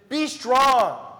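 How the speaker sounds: Be strong lifted high and loud; emphatic repeat. Shown as a demonstration. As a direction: say Be strong to snap wandering attention. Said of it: "If you're not paying attention".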